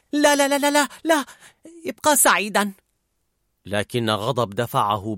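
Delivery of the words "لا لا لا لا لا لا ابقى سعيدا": very high, loud panic; panicked yelling. A narrator's reading. Fear trying to stop Anger, panicked. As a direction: cry no no in terror. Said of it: "صرخ خوف".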